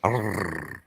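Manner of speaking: long trilled R trailing down; rolled R. Shown as a demonstration. Asking if Hispanics do that, rolled R. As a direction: demonstrate a drawn-out rolled R. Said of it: "she wouldn't quit rolling her R's".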